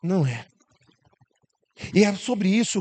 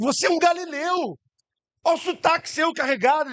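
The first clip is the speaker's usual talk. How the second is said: high accusation; 8 semitones higher than usual. Acted.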